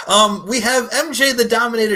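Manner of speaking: high, loud announcer call; hyped ring announcer intro. Pretend. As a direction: announce her like a fight host.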